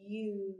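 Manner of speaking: U drawn out from a y glide into oo; long vowel U. Shown as a demonstration. Teacher shows the changing mouth, U stretched out. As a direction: hold the letter name U long. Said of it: "Can you say it with me?".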